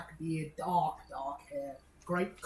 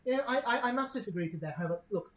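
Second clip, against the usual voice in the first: polite lady; 6 semitones higher, 5 dB louder, faster than usual. Acted. GM voices polite noble lady.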